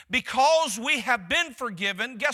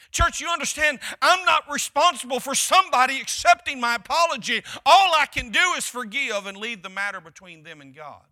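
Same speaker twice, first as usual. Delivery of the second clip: preaching loud; 3 semitones higher, 8 dB louder than usual. Acted. Preacher driving point home.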